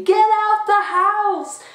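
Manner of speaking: loud, high, drawn-out yell; shouting at the TV. Acted. Viewer warning film characters about the killer doll, shouting. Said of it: "We're shouting at the T V".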